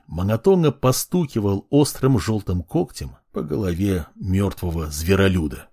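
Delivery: ordinary delivery, nothing marked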